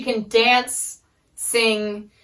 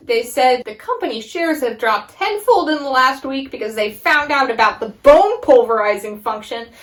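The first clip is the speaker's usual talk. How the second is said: usual speaking voice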